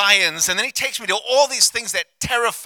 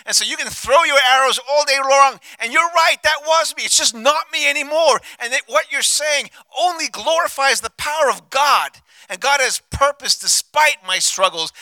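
higher and louder, driving sermon climax; full-force pulpit defiance; fiery preaching; 6 semitones higher, 4 dB louder than usual. Acted. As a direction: preach it hard to the accuser.

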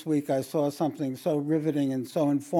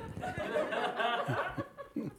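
high-pitched giggle; 7 dB quieter than usual. Real, not acted. High laugh after clip.

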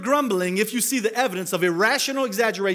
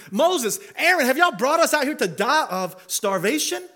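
whining accusation; 7 semitones higher, 5 dB louder than usual. Retold or imitated. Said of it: "there is this exaggeration".

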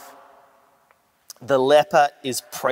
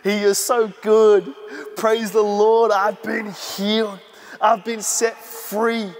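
joyful shout of praise; 5 semitones higher, slower than usual. Retold or imitated. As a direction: shout it out like a healed man rejoicing. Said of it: "he's now yelling".

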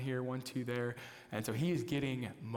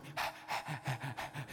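put-on soft, breathy toddler huffs; imitated baby panting; 4 dB quieter than usual. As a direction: imitate an excited baby shaking a can. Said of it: "he's going".

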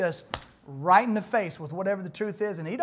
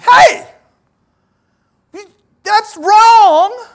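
much higher and louder, slow outraged yell; mock indignant shout; 12 semitones higher, 16 dB louder, much slower than usual. Moviegoer shouting at someone who cut the line, mock outrage.

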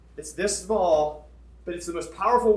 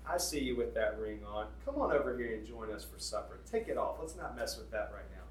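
gentle knowing Tom; 6 semitones lower, 8 dB quieter than usual. Retold or imitated. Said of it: "He looks over at him and goes".

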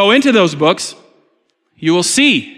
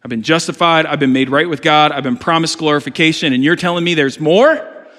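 ordinary delivery

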